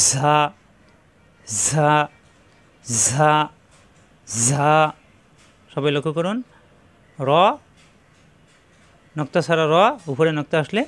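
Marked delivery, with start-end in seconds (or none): 0.1-4.7 ঝা with a long buzzing z; whistling letter. Shown as a demonstration. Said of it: "জিহ্বা নিচের দাঁতে চাপ দিয়ে".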